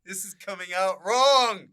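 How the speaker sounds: escalating hammed-up shout for help; mock-panicked yell. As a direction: build to a high, loud shout. Mock panic, calling to the editor.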